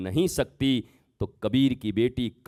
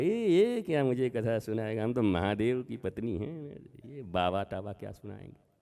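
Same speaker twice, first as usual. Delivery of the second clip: softer, scoffing aside; dismissive Sati; 7 dB quieter than usual. Acted. Sati scoffing at the sage's discourse, softly dismissive. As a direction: brush the sage off under her breath. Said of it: "तो माता सती ने क्या कहा".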